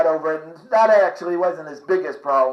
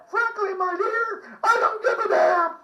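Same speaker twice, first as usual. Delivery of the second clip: Southern belle voice; 6 dB quieter than usual. Retold or imitated. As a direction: play a swooning movie heroine, pitched way up. Gone with the Wind quote.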